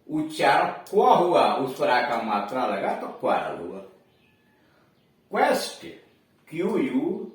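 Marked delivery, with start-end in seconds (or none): 5.3-5.9 क्वेस्ट said kwest with qu as kw; high, clear model of क्वेस्ट starting kw; teacher models the qu sound. Shown as a demonstration. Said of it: "क्व, क्व पर ई की मात्रा".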